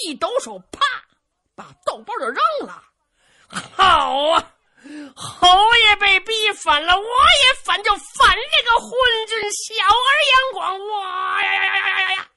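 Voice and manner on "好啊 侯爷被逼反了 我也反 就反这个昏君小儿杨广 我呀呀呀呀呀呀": much higher and louder, sobbing rage ending in a roar; furious crying shout. Acted. Zhu Can vows rebellion against the emperor, furious tearful roar. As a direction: explode in tearful fury.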